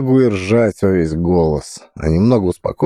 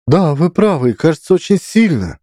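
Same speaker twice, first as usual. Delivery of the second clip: high woman's voice; 10 semitones higher than usual. Acted.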